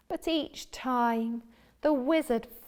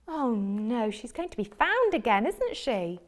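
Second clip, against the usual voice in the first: ordinary delivery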